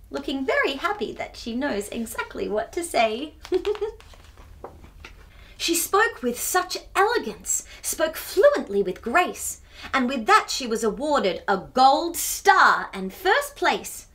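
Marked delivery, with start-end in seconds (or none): none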